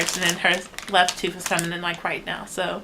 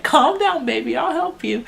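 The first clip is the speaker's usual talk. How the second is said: put-on soothing baby talk; 7 semitones higher, 4 dB louder than usual. Mom soothing frustrated baby.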